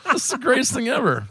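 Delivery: gushing through real laughter; laughing. Real, not acted. Laughing as he tells friends he loves it. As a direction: laugh the words out with delight.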